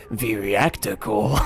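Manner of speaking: slow, drawn-out put-on voice; words read differently. Shown as a demonstration. Streamer showing how they randomly read words, slow put-on voice. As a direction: stretch it out in a put-on game voice. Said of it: "I just read words differently".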